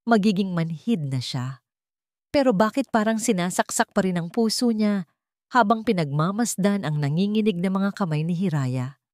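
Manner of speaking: ordinary delivery, nothing marked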